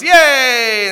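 put-on high, loud child's yay; a boy's squeal of delight at the news; excited cheer. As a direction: cheer like a thrilled little boy. Small boy cheers, runs out.

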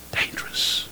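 unvoiced, hushed warning word; whispered warning. Preacher warning the congregation about words, whispered. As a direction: breathe it like a secret.

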